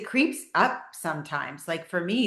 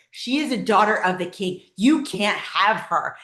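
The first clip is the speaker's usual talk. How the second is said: shouting; 4 semitones higher, 8 dB louder than usual. Retold or imitated. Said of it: "I was shouting out into the atmosphere".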